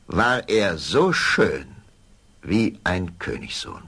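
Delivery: plain reading voice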